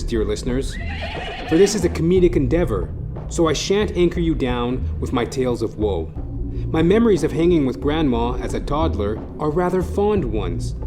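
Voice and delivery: ordinary talk, nothing marked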